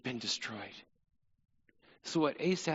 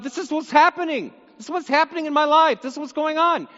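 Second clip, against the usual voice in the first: higher, louder urgent plea; a preacher acting out someone telling God their troubles, urgent and higher; 8 semitones higher, 5 dB louder, faster than usual. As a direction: pour it out to God, pressing. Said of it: "sometimes you just need to tell God".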